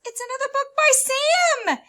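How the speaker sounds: very high, loud delighted line; excited librarian. Acted. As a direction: announce it with thrilled surprise.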